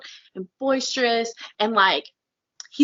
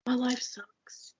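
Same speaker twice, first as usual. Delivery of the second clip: mocking whispered moan, slow; whiny whisper; 10 dB quieter, much slower than usual. Mocking a lazy couch complainer in a slow whisper. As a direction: breathe it out like a limp complainer.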